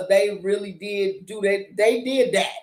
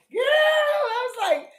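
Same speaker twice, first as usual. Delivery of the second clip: laugh-crying. Real, not acted. Laughing cry of disgust.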